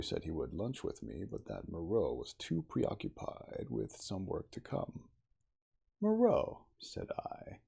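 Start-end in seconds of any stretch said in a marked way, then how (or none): 6.0-6.7 sharply raised, startled cry of the name. A narrator's reading. Narrator voicing the startled hero recognizing a name. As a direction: blurt out the name in shock.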